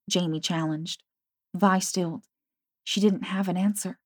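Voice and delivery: ordinary delivery, nothing marked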